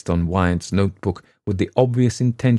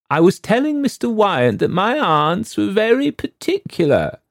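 put-on higher, prim young lady; young wife's voice; 14 semitones higher, 4 dB louder than usual. A narrator's reading. Wife prim before the painter, higher voice. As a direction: speak up primly to the guest. Mrs Lombard speaking.

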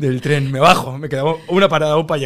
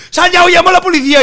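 high, loud threat to call police; retold angry owner threatening; 12 semitones higher, 10 dB louder than usual. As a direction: yell the threat furiously.

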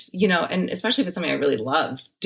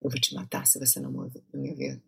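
quiet menacing threat; 2 semitones lower, 10 dB quieter, much faster than usual. Shown as a demonstration. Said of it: "Okay, say".

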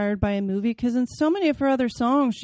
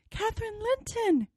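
put-on higher, trembling child's voice; shivering ghost child; 12 semitones higher, 5 dB quieter than usual. Ghost girl naming herself at the window, high shivery. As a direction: answer as a cold, lost girl. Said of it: "it replied, shiveringly".

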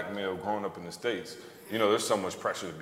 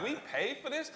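incredulous; 5 dB quieter than usual. Retold or imitated. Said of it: "I'm like".

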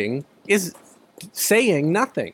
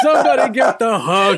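laughing; 5 semitones higher, 5 dB louder than usual. Real, not acted. Laughing at the pun.

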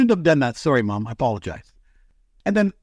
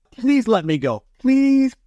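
pleading, pitch jumping higher on the repeated please; retold begging to be let go; begging the boss; 6 semitones higher, slower than usual. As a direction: beg like a kid asking permission. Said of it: "So I said to my boss".

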